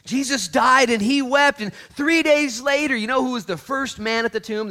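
very loud, high-pitched proclamation; shouted preaching. Real, not acted. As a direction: shout the story to the congregation.

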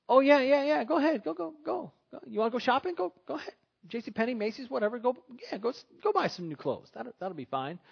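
high, eager mock permission; over-agreeable husband. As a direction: wave the wife off to shop in a high-pitched voice. Acted husband urging wife to shop.

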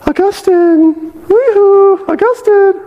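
loud falsetto yoo-hoo call; hammed-up mistress hailing her old lover in a very high voice; flirty woman calling out. Former mistress hails Augustine in the street, shrill mock falsetto call. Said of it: "Probably was much better than that".

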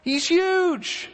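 high, mock-alarmed gossip; hammed-up awe of the giant; frightened soldiers. Preacher voicing Israel's men, scared of Goliath, pitched high. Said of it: "They said".

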